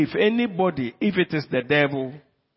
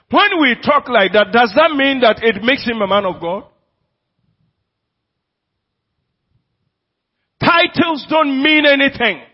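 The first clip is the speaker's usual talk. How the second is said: higher and louder as it builds; climbing fervent preaching; building sermon climax; 6 semitones higher, 8 dB louder than usual. Acted. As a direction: rise to a ringing pulpit peak. Preaching at full force.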